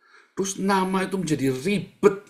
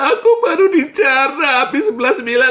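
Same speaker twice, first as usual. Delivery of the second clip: high, loud laughing whine; mock whining rich man; 12 semitones higher, 9 dB louder than usual. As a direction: lampoon a rich man complaining through laughter. Menirukan orang kaya yang mengeluh. (rengekan orang kaya yang diejek, tinggi dan tertawa)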